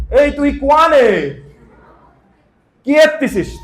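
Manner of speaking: loud, high regional Bangla call; home regional accent. Shown as a demonstration. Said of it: "ওটা হচ্ছে আমার accent". (বাড়ির আঞ্চলিক টানে চেঁচিয়ে ডাকা)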